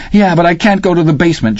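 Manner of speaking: a scared schoolboy pleading; boy faint with fright; frightened boy. Acted. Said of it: "Charles said, feeling faint".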